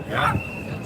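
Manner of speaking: usual speaking voice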